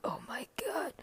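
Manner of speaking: high, very soft exclamation; whispered amazement. Retold or imitated. Overwhelmed by Misha's parting question, whispered amazement.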